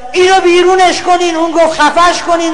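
loud mock yell of an offended crowd; women in the audience shout at the speaker, angry mock yelling; retold shout. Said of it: "داد زد".

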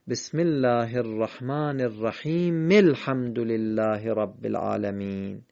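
slow recitation, الرحیم running into الحمد as "rahimi-l-hamd"; linked verses. Shown as a demonstration. Teacher linking two verses, "rahimi-l-hamd" joined slowly at الرحیم. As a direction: join الرحیم to الحمد through the short vowel, no pause. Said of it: "اگر آیات رو ما به یکدیگر وصل کردیم".

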